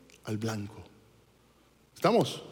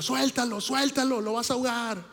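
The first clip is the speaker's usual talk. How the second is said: mock shout of lifeguards, pitched higher; retold yelling; 7 semitones higher than usual. Lifeguards calling to the wife, mock raised-pitch yell. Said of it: "le empiezan a gritar".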